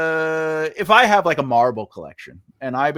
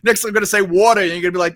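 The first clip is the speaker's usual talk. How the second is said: ordinary delivery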